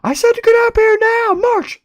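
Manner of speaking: very high, loud commanding shriek; screaming parent. Acted. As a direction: order him up like a furious mother. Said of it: "the voice upstairs screams at him".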